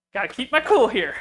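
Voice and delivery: mock outrage, voice climbing as patience snaps; losing his cool. Retelling his booth rant to the Moroso rep, heated and loud. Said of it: "I was a little upset".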